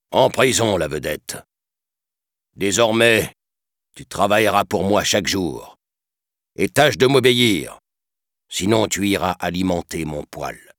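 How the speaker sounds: directeur cruel, voix plus forte; méchant menaçant. A narrator's reading. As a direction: menace un prisonnier avec moquerie. Stromboli menace le pantin. (voix de méchant menaçant)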